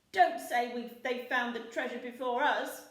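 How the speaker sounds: loud, fretful pirate voice; a pirate fearing they're too late; worried pirate. A narrator's reading. Percy Patch frets to crew, loud. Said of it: "said Percy Patch".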